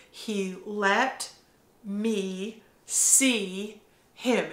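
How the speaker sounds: "He let me see him" stretched very slowly; hushed, drawn-out wonder; slow and reverent. Real, not acted. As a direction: savour each word in awe. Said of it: "slowed repetition of key phrase".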